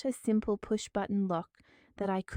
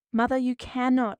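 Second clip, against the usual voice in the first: loud, higher protest; crying out; 4 semitones higher, 7 dB louder than usual. Acted. Daughter protests as the door shuts, crying out. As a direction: cry out as the door closes. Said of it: "I cried out".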